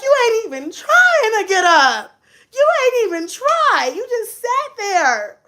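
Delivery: high wailing mock complaint; hammed-up tearful outrage, drawn out at the end; exasperated, near tears. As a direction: scold as if about to cry. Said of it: "I was ready to cry".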